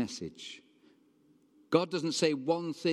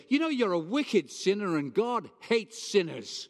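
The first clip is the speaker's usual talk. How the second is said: mock hellfire preacher; 8 semitones higher, 4 dB louder than usual. Mock condemning street preacher.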